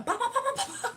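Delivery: high playful ba-ba-ba for stumbling steps; mock stumbling sounds. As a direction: chant the stumbles high and silly. Mimicking steps that keep failing.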